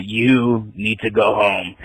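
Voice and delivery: low, slow eerie imitation; creepy woman voice. Imitating her as she closes in, low slow creepy. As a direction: intone it slowly like someone possessed.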